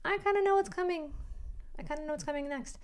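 high, light musing voice; imagined inner thought. Retold or imitated. The listener's inner voice, pitched high and knowing. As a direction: voice your own knowing thought, lifted high.

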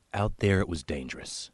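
usual speaking voice

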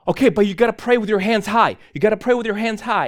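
high, loud, urgent mock order; imitated frantic insistence. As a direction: insist loudly like the agitated kid.

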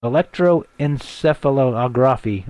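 slow, careful sounding-out of a long word; tackling a hard word slowly; unsure pronunciation. Real, not acted. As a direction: feel your way through Electroencephalography syllable by syllable. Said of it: "how do I say this".

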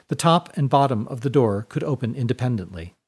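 usual speaking voice

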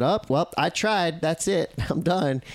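high, sing-song giving up with a laugh; mock quitter. Host mimics quitters after a wipeout, mock defeated and high. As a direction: play the guy who quits after one wipeout. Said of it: "came into the boat and said".

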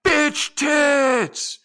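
high, loud yell with drawn-out words; sudden heckler's shriek across a silent theater; full-throated scream. Retold or imitated. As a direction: scream it at the top of your lungs. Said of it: "I screamed at the top of my lungs".

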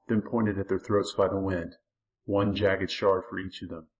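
ordinary delivery, nothing marked

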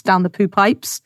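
usual speaking voice

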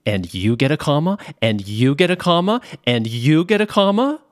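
mock Oprah announcing prizes, climbing with every line; Oprah giveaway. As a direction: hype it up like a talk-show host handing out prizes. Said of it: "You're going to be Oprah Winfrey handing out cars".

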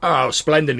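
pleased dwarf booming back. Acted. Dwarf welcomed to share the fire, loud reply. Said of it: "He sort of, like".

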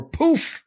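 high cartoon poof in passing; a light playful poof as things appear; poof sound effect. Shown as a demonstration. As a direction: flick in a quick magic poof. His chosen poof sound effect.

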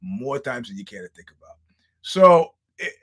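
ordinary talk, nothing marked

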